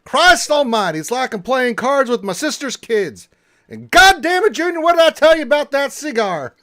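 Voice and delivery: high, loud acted tirade; full-volume movie-quote outburst; exasperated yelling. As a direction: holler it like a furious uncle at the card table. Acts angry movie quote.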